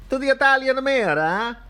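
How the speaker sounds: high, hammed-up Italian accent; mayor calling out in a broad Italian accent.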